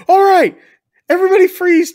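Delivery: high, loud retold "freeze" order; mock shouted command. Fake Coast Guard man pulls a gun on diners, mock shouted command. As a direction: imitate an armed officer yelling out.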